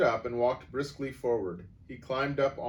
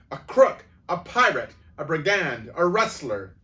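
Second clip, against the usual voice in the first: much higher, louder string of insults; screaming; 7 semitones higher, 12 dB louder than usual. A narrator's reading. Headmistress branding the boy, screaming. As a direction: hurl each name at him. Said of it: "the Crunchbull screamed".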